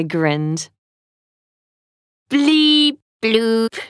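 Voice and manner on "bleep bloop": lightly voiced robot beeps; playful robot noises. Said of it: "said the twins".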